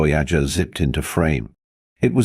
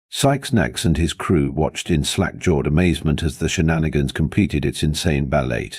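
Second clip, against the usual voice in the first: plain reading voice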